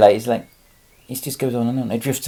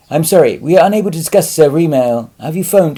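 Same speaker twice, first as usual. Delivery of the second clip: louder, higher mock customer service reply; mock automated message; 6 semitones higher, 8 dB louder than usual. Mocking the canned reply he gets from the DVLA, in a louder, higher voice. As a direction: parrot the canned web chat brush-off. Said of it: "get a reply saying".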